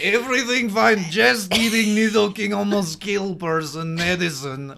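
loud, broken-English fake accent; mock radio caller's accent. Mocking the bluff given over the radio, loud fake accent. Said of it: "Who can do this accent?".

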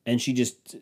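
ordinary delivery

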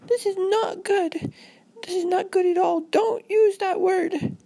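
put-on very high-pitched panicked girl; hammed-up squeaky panic; freaking out. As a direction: wail it like a terrified child. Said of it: "so that really made Robin freak out".

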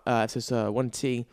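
ordinary talk, nothing marked

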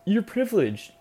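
high, loud mocking voice; shrill mock of online haters; mocking critics. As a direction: sneer it like a whiny critic. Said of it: "everyone's gonna be like".

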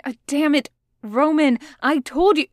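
angry. Acted. Logan snaps at Roman.

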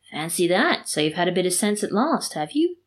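ordinary delivery

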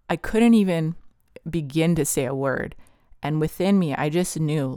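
plain reading voice

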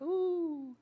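a high, soft little ooh as the water bubbles; high delighted ooh. Pretend. Feeling waves over your feet, a high soft ooh. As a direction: squeal softly at the bubbly waves.

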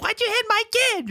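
very high-pitched mock squawk; outraged parent. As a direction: squeal it like an indignant parent. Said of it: "the parents be like".

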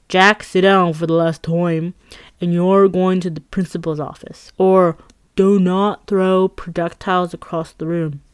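a hint of a fed-up Boston teacher, slowed; slow Boston accent, scolding the class; thick Boston accent. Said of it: "insert thick Boston accent".

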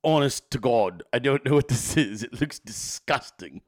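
judge the dish like a scornful TV chef; Gordon Ramsay. Retold or imitated. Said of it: "my best fucking Gordon Ramsay impression".

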